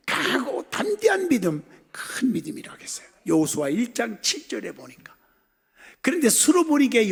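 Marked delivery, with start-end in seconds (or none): none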